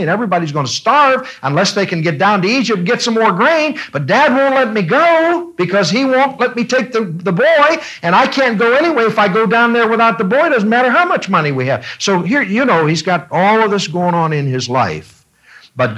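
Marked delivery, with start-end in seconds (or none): 3.9-11.9 higher, fast anxious pleading; worried Judah. Retold or imitated. Preacher voicing Judah fretting over his father, higher and rushed. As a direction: rush it out like a trapped son. Judah voiced in retold story.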